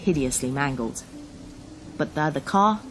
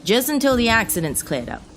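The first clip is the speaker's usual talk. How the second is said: officer giving orders; 7 semitones higher, 5 dB louder than usual. Acted. Officer instructing driver in storm.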